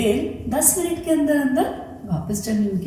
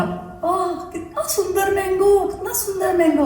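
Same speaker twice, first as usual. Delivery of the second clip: put-on admiring child; 9 semitones higher than usual. Chinku admires the mango.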